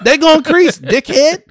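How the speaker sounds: fed-up yell at a customer; mock yell. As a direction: shout it at the fool. Repeating rant at customers.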